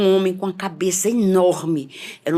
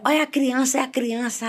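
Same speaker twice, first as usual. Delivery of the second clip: alarmed cry; 6 semitones higher than usual. Retold or imitated. Frantic vision of the child.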